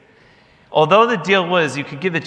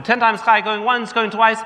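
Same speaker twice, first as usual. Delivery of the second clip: higher, louder going-once call; auctioneer call; 9 semitones higher, 4 dB louder than usual. Retold or imitated. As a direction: close the sale like an auctioneer. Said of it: "I said".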